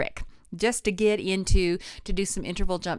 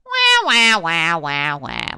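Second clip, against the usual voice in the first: bright louder wah, like a duck; quacking wah; 2 semitones lower, 8 dB louder, much slower than usual. Shown as a demonstration. Voice teacher leading the quacking wah warm-up. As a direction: quack it out, loud and twangy. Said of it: "Like you're quacking".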